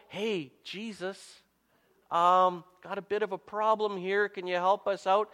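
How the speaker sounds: softly and hesitantly, a casual little ask; gentle, polite request. Retold or imitated. Disciple timidly nudging sleeping Jesus for help, gently. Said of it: "This was no gentle".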